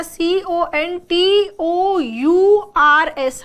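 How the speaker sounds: सीओएनटीओयूआरएस read as separate letters, slowly; spelled out letter by letter. Shown as a demonstration. Teacher spells सीओएनटीओयूआरएस slowly for the students. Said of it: "contour में यहाँ".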